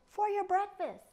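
high, loud presentation; announcing. Acted. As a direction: present it like a grand prize. Eagle presents fake beetles.

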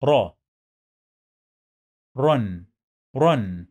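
را opened out, then "ran" with final n; ra with tanween. Shown as a demonstration. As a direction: model Run as rolled Arabic "ran". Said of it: "حرف الراء هنا هيتنطق بالشكل ده".

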